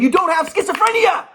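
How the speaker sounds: high, loud doctor voice; mock the psychiatrist's verdict; quoting the psychiatrist. Telling how the psychiatrist cleared him, high and loud.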